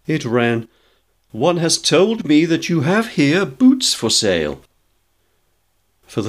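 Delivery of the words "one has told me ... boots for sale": high, prim recital from a phrasebook; earnest, stilted customer speaking far above usual pitch. A narrator's reading. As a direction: read it out high and formal. George reads absurd phrasebook sentence aloud.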